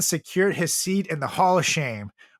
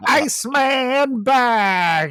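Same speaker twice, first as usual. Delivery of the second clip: high, loud, drawn-out ring intro; wrestling ring announcer; 9 semitones higher, 5 dB louder than usual. Pretend. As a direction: boom it like a WWE announcer. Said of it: "the cringiest W W E catchphrase".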